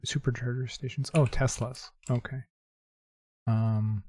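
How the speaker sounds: usual speaking voice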